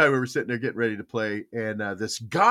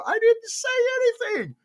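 high, put-on innocent voice; feigning innocence to grandma; mock innocence; faster than usual. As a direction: protest you said nothing.

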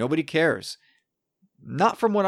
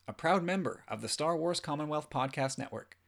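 announcer voice; 9 dB quieter than usual. Acted. Recorded podcast intro.

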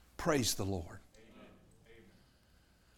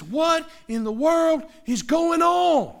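higher, amazed and drawn out; astonished crowd; 11 semitones higher, 5 dB louder, slower than usual. Retold or imitated. Bystanders amazed at Zacharias speaking again, slow and astonished. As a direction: ask it in wide-eyed wonder.

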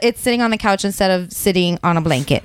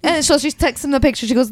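usual speaking voice

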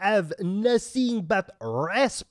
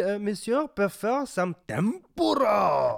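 mock French accent; 3 semitones higher than usual.

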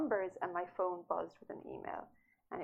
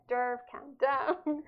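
slow, loud, higher imitation of a husband; exasperated calming; 5 semitones higher, 8 dB louder, much slower than usual. Husband reining in panicking author on phone, exasperated calming. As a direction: drag out a mock-firm order to settle.

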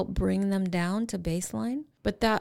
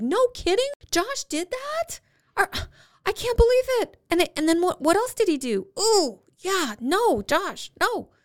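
much higher, louder, matching the upset; animated mirroring; 13 semitones higher, 5 dB louder than usual. Shown as a demonstration. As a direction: mirror the kid's outrage, big and animated. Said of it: "you can actually get kind of animated".

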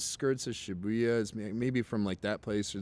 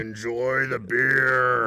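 husky voice; 8 dB louder, much slower than usual. Shown as a demonstration. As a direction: drag out each word, husky. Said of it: "Uh, husky".

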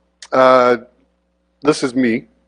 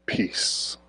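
whispered peace; 11 dB quieter than usual. Shown as a demonstration. Preacher reading the verse to the congregation, whispered. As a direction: breathe the word peace out as a soft whisper. Slow read of Bible verse.

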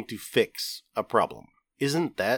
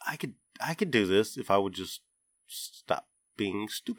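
quiet self-reproach; 7 dB quieter than usual. Retold or imitated. Watching organized friends, quietly scolding himself. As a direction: talk yourself down under your breath. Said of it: "I'm also like".

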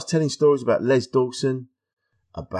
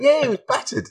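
laughing; 4 dB louder than usual. Retold or imitated. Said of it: "He went".

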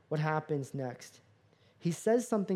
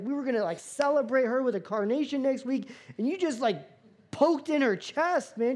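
ordinary talk, nothing marked

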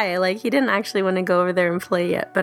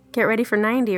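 ordinary talk

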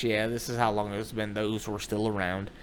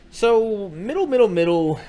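higher, much louder rattled-off summary; loud skipping-ahead filler; 8 semitones higher, 9 dB louder than usual. Shown as a demonstration. Comic glossing over his own story, loud and high. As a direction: raise it and gloss over the middle.

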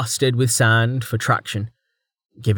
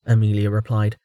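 ordinary delivery, nothing marked

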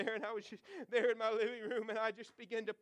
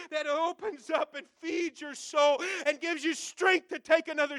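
rising louder and higher, slowing into a shout; building preaching shout; 4 semitones higher, 6 dB louder than usual. Real, not acted. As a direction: preach it harder and harder.

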